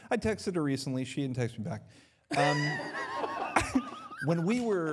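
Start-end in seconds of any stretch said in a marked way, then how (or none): 2.6-4.2 big high laugh bursting out; high burst of laughter. Real, not acted. Laughing about unanswered text.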